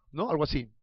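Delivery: ordinary talk, nothing marked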